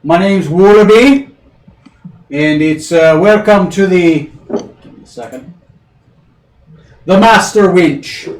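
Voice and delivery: boisterous barmaid welcome; burly barmaid. Acted. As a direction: greet customers like a hearty innkeeper. GM plays bartender Wallaby.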